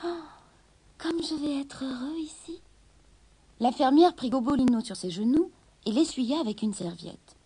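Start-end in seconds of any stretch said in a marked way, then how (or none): 0.0-2.6 whispering. A narrator's reading. Kitten's happy private thought.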